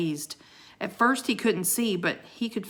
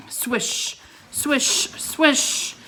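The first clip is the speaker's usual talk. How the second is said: whooshing swish; 7 semitones higher, much slower than usual. A narrator's reading. Sleigh sound.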